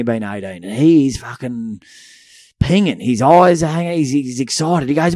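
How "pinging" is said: ordinary talk, nothing marked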